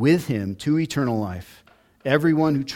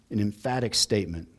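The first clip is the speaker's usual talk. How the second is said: ordinary talk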